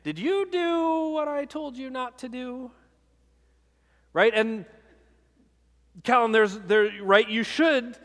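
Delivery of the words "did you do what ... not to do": put-on higher voice of a dad questioning his kid; dad confronting his child who disobeyed; scolding dad. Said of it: "hear a dad saying to his kid".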